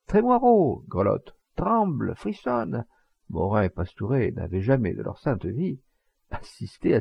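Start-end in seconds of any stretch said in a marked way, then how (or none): 0.1-2.9 high-pitched, drawn-out jeering call; crowd crying out. A narrator's reading. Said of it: "Et elle crie". (cri de la foule)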